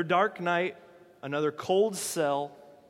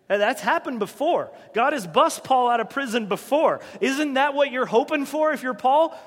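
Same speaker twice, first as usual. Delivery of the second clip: preaching louder; 5 semitones higher, 6 dB louder than usual. Real, not acted. Rising sermon emphasis.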